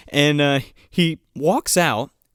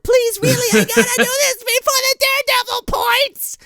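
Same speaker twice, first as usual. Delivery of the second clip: much higher, louder laughing plea; desperate begging; 3 dB louder than usual. Retold or imitated. Hero pleading with her for a date, high and loud. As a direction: beg through laughter.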